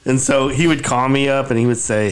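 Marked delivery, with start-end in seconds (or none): none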